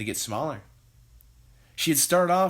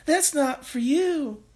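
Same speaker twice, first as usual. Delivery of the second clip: high, pleading mother's daughter voice; Missus Cranky pleading; 5 dB louder, slower than usual. A narrator's reading. Daughter begging her mother to stop, high pleading voice. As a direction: beg her mother not to take it. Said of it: "cried Missus Cranky".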